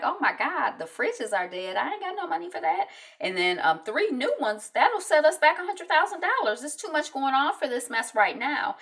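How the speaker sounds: high, exasperated complaining. Retold or imitated. President hearing the fridges died, high exasperated rant. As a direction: throw up your hands, pitched high.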